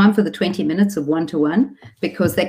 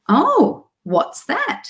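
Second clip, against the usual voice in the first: put-on high-pitched, sing-song question to a toddler; quizzing a child; 10 semitones higher, much slower than usual. Parent testing a child on a word, sing-song quizzing. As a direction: prompt a small child, pitch swooping up.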